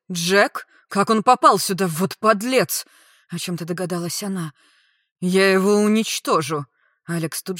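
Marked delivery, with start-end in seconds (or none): 0.1-2.8 loud disbelief turning to outrage; shocked indignation. A narrator's reading. Friend hearing who attacked, indignant. 5.2-6.7 loud, forceful threat; furious vow. A narrator's reading. Friend learning of the attack, furious.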